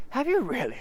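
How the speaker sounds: put-on high, bright boy voice; eager boy. Christopher Robin amazed at Eeyore's news, high and eager. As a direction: sound like a keen young boy.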